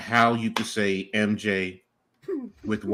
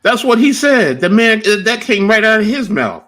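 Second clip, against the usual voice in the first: much louder and higher, insisting; loud, emphatic, talking over everyone; loud insistence; 12 semitones higher, 12 dB louder than usual. Real, not acted.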